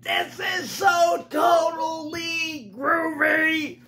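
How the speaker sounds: high, slow, loud gleeful shout; excited cry. Acted. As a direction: shout it like the best ride ever. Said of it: "Pete the Cat cried".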